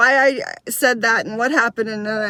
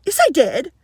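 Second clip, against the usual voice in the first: put-on higher, offended child's comeback; indignant protest; 6 semitones higher, 4 dB louder than usual. Girl contradicting her teacher, indignant and high. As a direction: insist you did, imitating a miffed kid.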